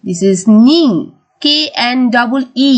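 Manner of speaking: ordinary delivery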